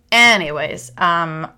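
ordinary talk

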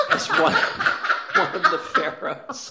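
high, breathless laugh through words. Real, not acted. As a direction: laugh it out, barely speaking. Laughing at Turturro casting.

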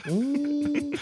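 high, drawn-out spooky ooh; mock spooky ooh. Teasing a friend's history lesson with a spooky ooh. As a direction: hoot it like a ghost.

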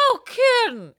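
high, loud mock outburst; crazy lady. Crazy lady learning it was a famous star, imitated loud outburst. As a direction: imitate her shrieked amazement. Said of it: "And she goes".

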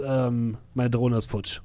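usual speaking voice

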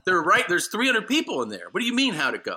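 loud, high-pitched ranting; mock-outraged at a needless question; retold indignant protest. Retold argument with the lady.

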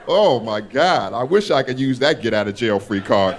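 ordinary talk

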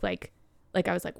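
ordinary talk

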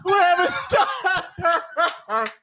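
high, slow, laughing mom. Pretend. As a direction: stretch it out while laughing. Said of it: "the Raiola mom calling him for dinner".

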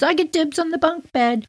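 little brother. Retold or imitated. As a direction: brag like a small boy. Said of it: "And he goes".

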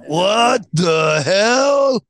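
drawn-out shouted what the hell; slow exaggerated shout; mock shout. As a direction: bellow it slowly, hamming it up.

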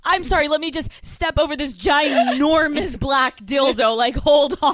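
loud, sing-song mechanic voice; mock mechanic. Mechanic stepping over toys, loud sing-song. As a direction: ham up the annoyed mechanic. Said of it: "And he's like".

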